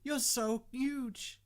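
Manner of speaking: high, slow breathless awe; gasping shout. Acted. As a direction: gasp it out, amazed. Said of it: "she shouted, gasping for breath".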